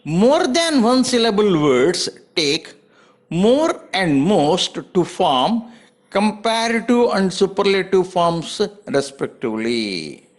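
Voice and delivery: slow, word by word; slow dictation. Shown as a demonstration. Teacher dictating the rule to students, very slow. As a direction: spell the rule out slowly for copying.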